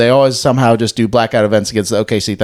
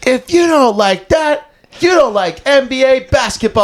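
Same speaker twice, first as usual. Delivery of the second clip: Grant Napier; 12 semitones higher than usual. Retold or imitated. Co-host asked for the famous dunk call, high announcer impression. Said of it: "can you give it to us?".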